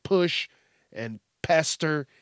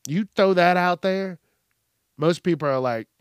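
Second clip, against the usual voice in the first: usual speaking voice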